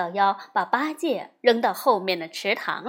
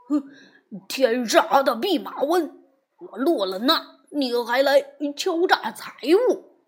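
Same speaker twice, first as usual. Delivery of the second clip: angry scolding, a bit louder; cursing; 2 semitones higher, 4 dB louder than usual. A narrator's reading. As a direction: rail at the monkey. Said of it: "乱骂".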